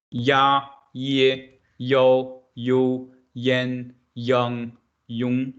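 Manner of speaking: "Ya, ye, yo, you, yan, yang, yong" each said separately and clearly; a teacher's drill of Mandarin syllables. Shown as a demonstration. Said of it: "think it as this sound in your English".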